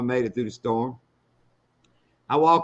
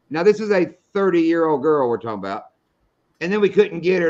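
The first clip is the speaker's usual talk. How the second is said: usual speaking voice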